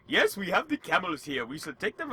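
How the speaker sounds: put-on hammy Middle Eastern oil baron accent. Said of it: "basically doing the most offensive Middle Eastern accent".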